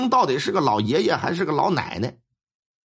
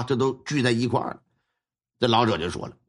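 normal talking voice

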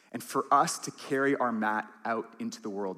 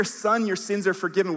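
loud, ringing proclamation from the pulpit; proclaimed forgiveness; 8 semitones higher, 7 dB louder than usual. Acted. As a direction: announce it boldly to the whole room. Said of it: "when we hear".

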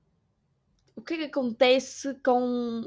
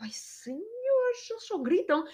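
high, breathy whispered sigh; whispered aside in exasperation; exasperated whisper; 12 semitones higher, 4 dB quieter, slower than usual. Retold or imitated. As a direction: sigh it under your breath. Weary aside about yelling staff.

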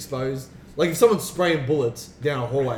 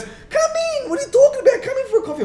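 much higher, sing-song mock sister voice; imitating the sister; 5 dB louder, faster than usual. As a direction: play the clueless sister inviting her in. Sister voiced in retold story.

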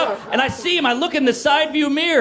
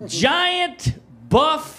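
ordinary talk

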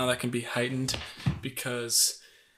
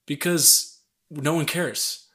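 ordinary talk

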